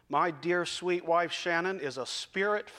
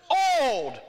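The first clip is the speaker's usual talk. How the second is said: calling out; 7 semitones higher, 5 dB louder than usual. Retold or imitated. Congregant calls out choice.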